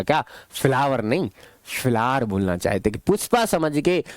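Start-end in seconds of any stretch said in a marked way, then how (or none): 0.0-2.2 imitating film dialogue. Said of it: "उसने गलत बोला था".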